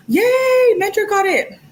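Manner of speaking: high, loud burst of celebration; gasp, then cheer them on; excited cheer. Real, not acted. Host celebrates the viewer who got the membership, excited.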